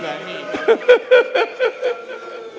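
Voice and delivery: a loud high cry of grief; real weeping wail; high wailing sob. Real, not acted. Wail after chanted lament.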